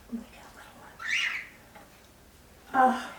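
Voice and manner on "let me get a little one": fast hushed whisper after a gasp. Real, not acted. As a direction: whisper it quickly like a secret.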